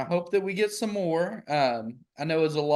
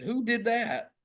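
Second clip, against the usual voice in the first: ordinary talk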